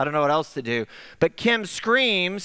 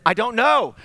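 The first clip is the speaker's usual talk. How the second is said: retold flustered reply; 5 semitones higher, 5 dB louder than usual.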